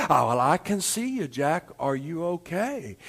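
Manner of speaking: soft, low, easygoing Lord; calm, hushed divine voice; God's voice from above. Acted. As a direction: speak down quietly, low and unhurried. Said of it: "he heard this voice".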